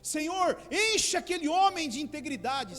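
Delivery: loud, high-pitched plea to God; acted-out loud prayer; mock fervent prayer. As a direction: cry out to the Lord in imitation of pleading prayer. Mock prayer begging God to act.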